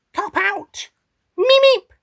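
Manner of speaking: very high squeaky creature; Meep character voice. Acted. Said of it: "lines for beep, the meep".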